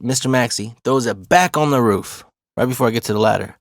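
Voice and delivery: usual speaking voice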